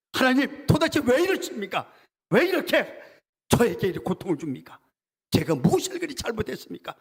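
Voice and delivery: high, loud anguished cry to heaven; crying out to God. Retold or imitated. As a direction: wail it upward in despair. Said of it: "소리를 치며 울부짖었다는 거예요". (하나님께 울부짖는 높고 큰 외침)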